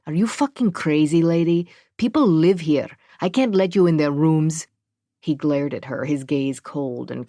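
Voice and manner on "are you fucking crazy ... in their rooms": angry. Acted. Super refuses search angrily.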